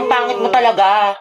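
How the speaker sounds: usual speaking voice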